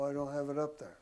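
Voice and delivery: normal talking voice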